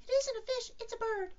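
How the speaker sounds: very high, squeaky little-animal voice; Piglet. Acted. Piglet correcting Rabbit about the bird, high little voice. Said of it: "said Piglet".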